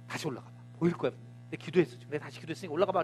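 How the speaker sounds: hushed insistence from Elijah; quiet urging. Retold or imitated. Elijah quietly sending the servant back up, coaxing. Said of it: "Elijah urging servant".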